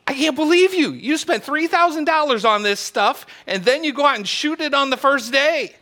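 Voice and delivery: high, loud mock outrage; hammed-up wife scolding her husband; wife railing. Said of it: "She keeps railing at him".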